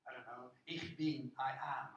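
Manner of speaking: ordinary delivery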